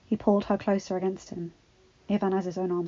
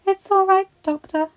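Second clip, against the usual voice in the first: quick reassurance; 12 semitones higher, 11 dB louder than usual. A narrator's reading. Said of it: "Belle said quickly".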